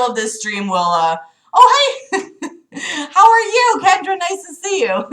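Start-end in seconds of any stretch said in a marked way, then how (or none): 1.5-5.1 excited greeting. Real, not acted. Greeting a viewer.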